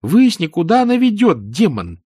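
high, loud bossy command; imperious youngster. A narrator's reading. Boy orders his demon to check the door, imperious.

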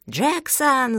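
loud, high-pitched call for the servant; shouted call. A narrator's reading. Old boss summoning his servant Jackson, shouting. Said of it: "закричал мистер Рефил".

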